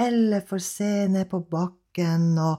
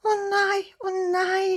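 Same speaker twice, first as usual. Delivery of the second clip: higher dismayed little cat; Elle the cat wails oh no; 11 semitones higher than usual. Acted. Elle despairs up in the treetop, higher dismay.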